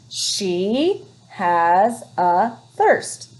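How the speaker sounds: She has a thirst read very slowly, word by word; teacher modelling slow reading; slow reading aloud. Shown as a demonstration. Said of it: "reading smoothly for class".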